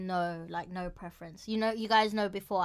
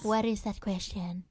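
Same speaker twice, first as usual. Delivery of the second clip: usual speaking voice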